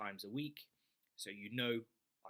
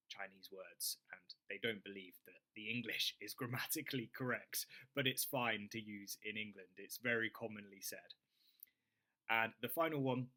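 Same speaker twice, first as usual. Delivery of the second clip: ordinary delivery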